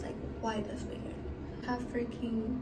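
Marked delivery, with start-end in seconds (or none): 0.0-1.1 whispered complaint. Real, not acted. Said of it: "I have a pimple".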